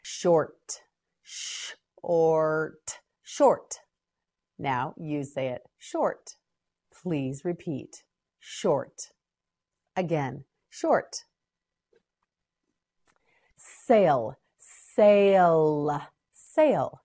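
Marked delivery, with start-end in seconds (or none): 1.2-3.0 "Short" slowly, long hissed sh held apart; slow sounding-out. Shown as a demonstration. Teacher models word slowly in parts. 14.6-16.0 "Sail" drawn out long and loud; slow modelling. Shown as a demonstration. Teacher models word slowly.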